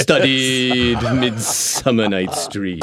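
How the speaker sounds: slow, drawling, haughty British thespian voice; Alan Rickman. Retold or imitated. Imagined thespian voice in his head during the audition, slow and haughty. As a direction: drag it out with lofty theatrical disdain. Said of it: "Alan Rickman is just running through my head going".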